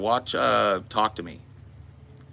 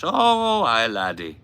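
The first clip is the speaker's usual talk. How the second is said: put-on Irish accent; 3 semitones higher than usual. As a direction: play a theatrical Irishman, swooping up high on key words. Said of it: "talk like an Irish".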